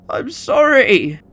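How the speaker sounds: slow, high, crying sorry; a broken last plea; tearful apology. Acted. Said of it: "eyes began to swell with tears".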